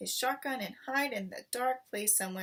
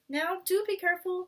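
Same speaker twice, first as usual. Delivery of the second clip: anxious wife; 10 semitones higher, 7 dB louder than usual. A narrator's reading. Missus Fox warning her husband, worried. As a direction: plead with him to be careful.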